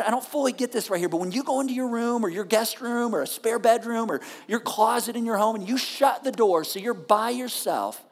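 normal talking voice

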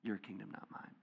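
soft, breathy surrender; humble prayer to God, whispered. Retold or imitated. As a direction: pray it under your breath. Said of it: "You're like".

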